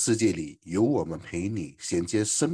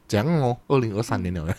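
usual speaking voice

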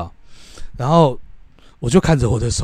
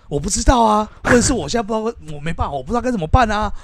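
high-pitched, exasperated self-defence; worked-up plea of having no idea; exasperated protest; 8 semitones higher, faster than usual. Real, not acted. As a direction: protest helplessly, pitch pushed up. Pressed, protests he didn't know.

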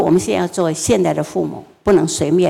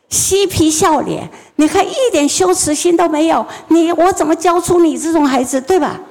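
higher, louder nonstop tirade; furious scolding mother; 9 semitones higher, 3 dB louder than usual. Retold or imitated. Mother berates her crying son, high and loud. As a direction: let the mother's fury pour out at the child.

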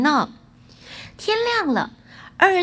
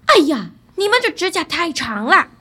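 raised voice, loud acted exclamation; 3 semitones higher, 5 dB louder than usual. A narrator's reading. Son remarking on the cats' long claws, loudly exclaiming. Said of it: "儿子大声地说道".

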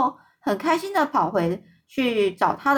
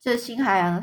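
usual speaking voice

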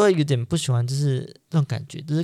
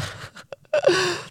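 short, high nervous giggle; nervous laugh. Real, not acted. Laughing nervously about being known by strangers. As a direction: laugh off the unease.

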